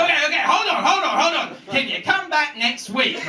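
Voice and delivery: high, flustered pleading; panicky stalling. Retold or imitated. Homeowner begging the man with the glove to wait, mock-panicky.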